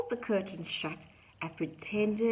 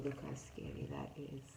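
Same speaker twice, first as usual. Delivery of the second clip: usual speaking voice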